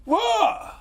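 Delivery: high, loud challenge; confrontational mock shout. Comic as local squaring up to visitor, high loud shout. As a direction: yell it as a sudden challenge.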